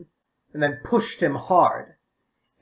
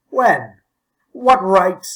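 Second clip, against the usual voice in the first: louder, sharp indignant demand; indignant outburst; 5 semitones higher, 8 dB louder, much slower than usual. A narrator's reading. Old man scandalized at boys climbing his wall, loud indignant demand. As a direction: bristle at the cheek of it.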